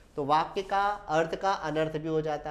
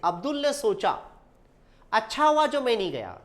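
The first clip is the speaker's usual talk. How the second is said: सोचा, a clear pause, then अच्छा louder; pause after सोचा; 3 semitones higher, 4 dB louder than usual. Shown as a demonstration. Teacher shows students the comma, pausing after सोचा. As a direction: stop briefly after सोचा before the thought. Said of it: "सोचा में थोड़ा सा रुके हम".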